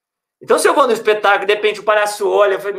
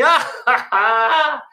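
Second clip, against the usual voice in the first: a short, higher imitated chuckle; imitated laugh; 5 semitones higher, much slower than usual. Patient sees two clowns at his bed, imitated laugh. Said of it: "Aí ele olhou e fez assim".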